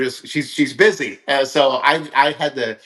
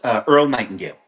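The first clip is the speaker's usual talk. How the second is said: ordinary talk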